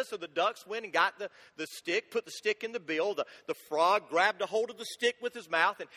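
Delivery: ordinary talk, nothing marked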